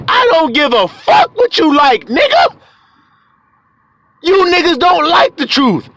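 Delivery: high, forceful dismissal; defiant holler. Real, not acted. Preacher answering the complainer, defiant holler. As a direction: throw it back hard and high. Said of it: "talk to your ass like this".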